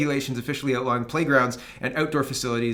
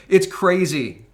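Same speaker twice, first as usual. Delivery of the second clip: It's crazy said louder with a hard fall on "It's"; "It's crazy" thrown out louder with a falling tune; louder exclaim; 4 semitones higher, 6 dB louder, much slower than usual. Real, not acted. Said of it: "I'm laughing, but it's crazy".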